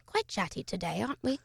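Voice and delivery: softly imitated British grandma, politely cutting; quiet British accent with a pointed question; gentle British put-down. British grandma hushing her talkative grandson, gentle but pointed. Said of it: "British people. They're very, like".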